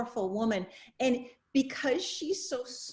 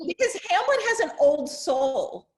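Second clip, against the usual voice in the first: laughing; 5 semitones higher, 6 dB louder than usual. Real, not acted. Laughing while making her point.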